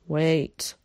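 ordinary delivery, nothing marked

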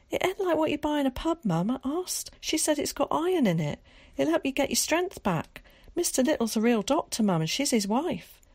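put-on a boy hurrying to explain to his mother; young boy Willie. A narrator's reading.

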